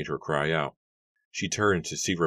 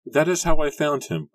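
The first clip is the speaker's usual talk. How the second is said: high, loud and shaken; a girl showing the fallen man, high and loud in shock; horrified cry; 11 semitones higher, 7 dB louder than usual. A narrator's reading. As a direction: cry it out in horror. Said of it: "Rebecca cried".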